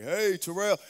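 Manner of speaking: a voice calling his name out loud; a loud outer voice calling Terrell; called out loud. Shown as a demonstration. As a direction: call his name as if from the sky. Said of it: "in here".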